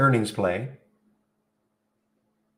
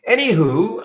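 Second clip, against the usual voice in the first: playful anywho; 12 semitones higher, 6 dB louder, slower than usual. Real, not acted. Playful sign-off to topic.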